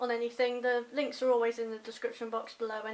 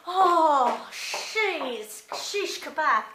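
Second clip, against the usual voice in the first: loud, higher startled yelp at the cold; cold shock exclamation; 4 semitones higher, 10 dB louder, much slower than usual. Real, not acted. Stepping outside into the cold morning, loud startled yelp. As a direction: gasp it out as the freezing air hits.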